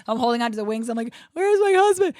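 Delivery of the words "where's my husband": high, loud wail; hammed-up tearful cry for a missing husband; mock weeping.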